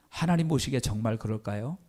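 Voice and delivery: ordinary delivery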